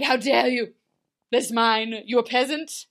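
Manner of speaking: loud, hammed-up indignant child; snotty little brat. Young Snow scolding servant over tiara, bratty outrage. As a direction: build to a high mock tantrum. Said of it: "Snow being a snotty little brat".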